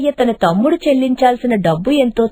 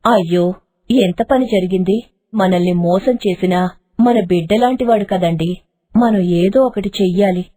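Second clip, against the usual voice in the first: concerned kind wife; 5 semitones lower than usual. Acted. Janaki tells husband they must help Govardhan, gently distressed. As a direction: sigh it out with pity.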